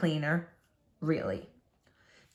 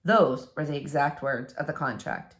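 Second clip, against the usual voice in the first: usual speaking voice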